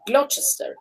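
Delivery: "Gloucester" in three syllables, GLOT-ches-ter; naive spelling pronunciation. Shown as a demonstration. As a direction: misread "Gloucester" with a ch. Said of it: "that's how you're gonna say it".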